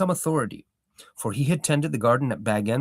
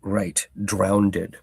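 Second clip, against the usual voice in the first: ordinary delivery, nothing marked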